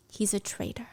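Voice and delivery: a breathless hushed whisper. A narrator's reading. Narrating Violet's realisation to viewers, whispered. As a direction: breathe it like a terrible secret.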